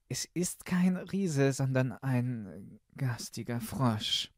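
put-on high, slow girlish voice; uneasy princess. Princess tells her father of the frog, high and slow. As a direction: complain reluctantly as the princess.

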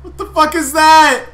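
high, loud horrified yell; scared screaming. Real, not acted. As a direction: scream at the monster, slowly. Monster appears, screaming.

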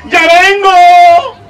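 high, loud, long drawn-out call; breezy calling out. Acted. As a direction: sing it out on the way out the door. Teen girl calling as she leaves. (aviso cantado, agudo y alargado)

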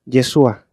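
Yeshua said as "ye-SU-a". Retold or imitated. Teacher imitating people who mispronounce the name, S for SH. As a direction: say Yeshua with a plain S, no SH. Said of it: "su pronunciación así es".

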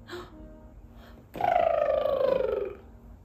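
gasp, then loud elephant trumpet. Acted. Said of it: "What does an elephant say?".

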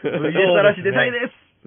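said out loud. Shown as a demonstration. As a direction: say it loud for all to hear. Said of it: "ここで声を大、 大にしていって".

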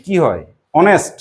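usual speaking voice

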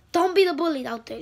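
loud, high angry shout; mad yelling. Real, not acted. Kid vlogger shouting at bullies, really mad. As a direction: yell it at the bullies. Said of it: "now I'm getting so mad".

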